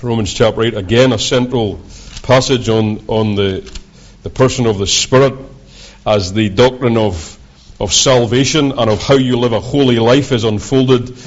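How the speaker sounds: ordinary talk, nothing marked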